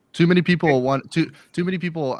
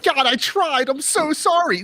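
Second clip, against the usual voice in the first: much higher, louder whiny plea; mock-beg forgiveness like a scolded child; hammed-up high whimpering apology; pleading believer; 5 dB louder, slower than usual. Imagined believer pleading at heaven.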